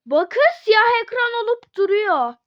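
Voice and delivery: loud, high-pitched alarm at the glitch; excited complaint. Real, not acted. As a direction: point it out urgently.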